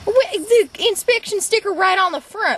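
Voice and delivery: indignant outburst. Acted. Driver outraged at cop's claims.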